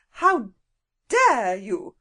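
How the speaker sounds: put-on furious woman at the children, voice shaking; shaking with anger. A narrator's reading. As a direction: let the voice shake with rage. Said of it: "her voice shook with angriness".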